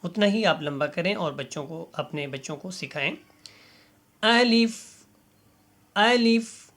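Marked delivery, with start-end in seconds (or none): 4.2-6.7 الف said crisply as alif, not aleef; clear letter demo. Shown as a demonstration. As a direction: model الف slowly for children to copy. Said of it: "اس کو الیف یا الف نہیں بولنا". (الف کو صاف اور واضح ادا کریں)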